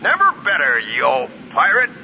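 put-on jovial host booms a welcome at his old friend; cheerful booming voice. A narrator's reading. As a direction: boom it out like a jovial old captain. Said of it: "Booster Terrick's cheerful voice boomed".

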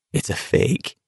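a shaky scared hush; trembling voice. A narrator's reading. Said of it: "his voice trembling".

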